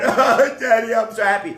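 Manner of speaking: high, hammed-up happy sobbing; crying for joy. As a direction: wail with mock tears of joy. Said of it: "was so happy to see his dad".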